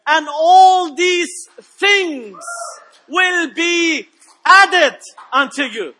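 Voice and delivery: high, loud declaiming sermon voice; full-force preaching to the congregation. Real, not acted. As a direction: stretch the scripture out and hammer the key words.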